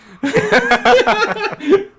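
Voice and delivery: loud burst of high laughter; big laugh. Real, not acted. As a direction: crack up loudly. Laughing at being whiff punished.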